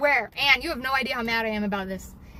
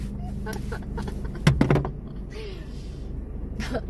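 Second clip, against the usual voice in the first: breathy laugh; 8 semitones higher, 3 dB quieter than usual. Real, not acted. Laughs at Chevy again.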